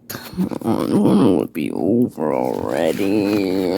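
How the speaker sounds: deep groaning voice. Pretend. Groaning at own story.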